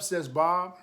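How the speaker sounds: ordinary delivery, nothing marked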